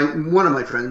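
normal talking voice

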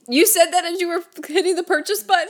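high, shocked question; shocked disbelief. Real, not acted. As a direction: gasp it out in disbelief. Shocked at the purchase.

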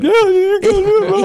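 very high, wailing mock sob; mock sobbing. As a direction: imitate blubbering while holding the baby. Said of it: "just sobbing".

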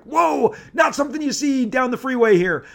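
wide-eyed high whoa. Retold or imitated.

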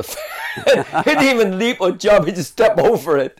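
can't hold back the laugh at the memory; laughing while telling. Real, not acted. Recalling tall Rossi stepping over the fence, laughing.

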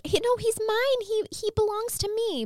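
high mock whiny protest; Annette's whiny claim. Mocking Annette at the arrest, high whiny claim. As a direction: squeak it out like a petulant child claiming the baby. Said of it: "Annette was like".

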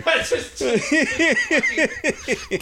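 high, bursting laugh; hearty laugh. Real, not acted. Laughing at the drunk street reenactment, high bursting laugh. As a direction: crack up at the memory.

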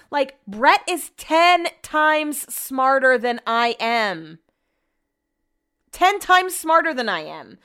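drawn-out, insistent stress on "ten times smarter"; exasperated emphasis. Real, not acted. Frustrated repetition about husband's smarts.

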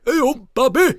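put-on Yorkshire accent. Mocking himself in a Yorkshire film, hammed-up Yorkshire accent. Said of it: "It's like me if I were in a Yorkshire film".

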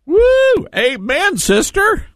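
high, whooping church-style amen; exuberant amen. Real, not acted. Host cheers caller's blessed greeting, exuberant.

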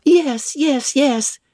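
put-on high-pitched young people's clamour; excited chorus of youngsters, unshouted; eager cry. A narrator's reading. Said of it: "cried all the juveniles".

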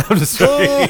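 laughing through the words. Real, not acted. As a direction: giggle out the admission. Laughs at own joke.

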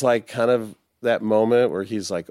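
normal talking voice

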